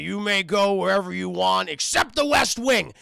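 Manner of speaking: loud, booming order; commanding Beast. Retold or imitated. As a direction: lay down the rule at high volume. Beast lays down the rule.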